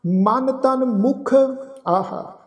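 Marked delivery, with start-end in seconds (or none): 0.0-1.3 very slow, drawn-out Gurbani recitation; scripture recitation. Acted. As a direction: recite each phrase slowly, holding the long vowels. Recites scripture between explanations.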